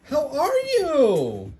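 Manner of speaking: high, very slow singsong; high drawn-out coo; dog greeting voice. Real, not acted. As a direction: stretch it out sweetly for the dog.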